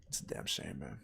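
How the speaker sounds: whispered aside; hushed, breathy comment under the breath. Real, not acted. Streamer whispering to chat after the scream, hushed aside.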